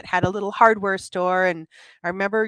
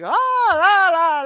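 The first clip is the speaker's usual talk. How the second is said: mock bad actress; 9 semitones higher, 4 dB louder, much slower than usual. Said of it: "She was the worst actress".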